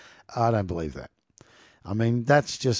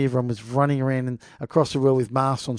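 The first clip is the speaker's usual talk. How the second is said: usual speaking voice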